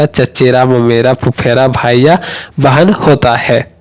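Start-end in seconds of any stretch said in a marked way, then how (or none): none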